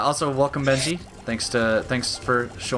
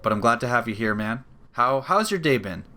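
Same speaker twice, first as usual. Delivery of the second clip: ordinary talk, nothing marked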